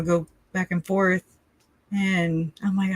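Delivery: ordinary talk, nothing marked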